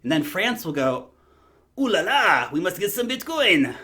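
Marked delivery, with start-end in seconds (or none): 1.8-3.7 hammed-up French accent, higher and louder; a deliberately terrible French accent, played for laughs; bad French accent. YouTuber mocking France in a bad French accent. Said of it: "It's my worst French accent ever".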